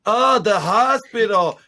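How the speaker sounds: high, loud, drawn-out "Oh, the hospital"; mock realization. Imitating Americans who finally understand, loud mock realization. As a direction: act out finally getting it, slowly and exaggerated. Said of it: "people were like".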